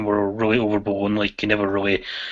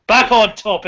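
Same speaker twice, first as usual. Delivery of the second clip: louder and higher, a chairman calling the room to order; mock stern call; 8 semitones higher, 6 dB louder than usual. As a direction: bang the gavel on "Back on topic".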